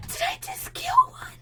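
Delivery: high breathless whisper of dismay; horrified under the breath; shocked whisper. Real, not acted. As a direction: gasp it out, horrified. Shock after killing cat.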